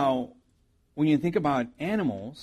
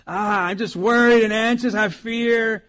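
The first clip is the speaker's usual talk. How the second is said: mock anxious; 7 semitones higher, 7 dB louder than usual. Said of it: "and you're like".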